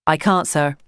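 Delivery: ordinary delivery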